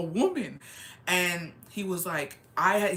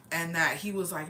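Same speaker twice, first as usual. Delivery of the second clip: ordinary talk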